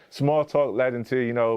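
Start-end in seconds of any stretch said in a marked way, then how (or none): none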